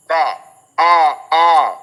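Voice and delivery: "ए" held alone as an open e; teacher drilling "ए" as a separate open sound; isolated vowel sound. Shown as a demonstration. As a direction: isolate the vowel in "बे, ए, ए", loud on the repeats. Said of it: "this is pronounced as".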